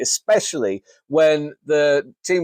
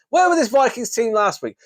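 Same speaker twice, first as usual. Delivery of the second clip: exasperated demand; 8 semitones higher than usual. Real, not acted. Ranting at the Vikings team.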